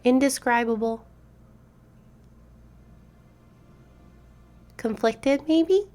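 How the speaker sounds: tiny high fairy voice, rising; a little pixie wondering aloud. A narrator's reading. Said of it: "you hear a tiny voice say".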